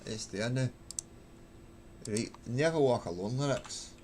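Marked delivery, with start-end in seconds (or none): 2.1-3.9 grumbling in Glaswegian; soft Glaswegian aside; Glaswegian accent. Shown as a demonstration. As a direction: mutter to yourself in a Glasgow accent. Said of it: "stuck in the Glaswegian accent".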